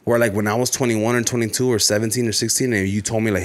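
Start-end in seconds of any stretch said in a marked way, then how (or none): none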